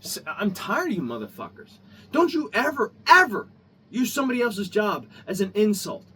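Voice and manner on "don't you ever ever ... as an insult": loud, raised-pitch scolding; angry warning. Real, not acted. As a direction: hammer each EVER as a warning. Angry warning at end of rant.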